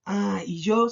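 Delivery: normal talking voice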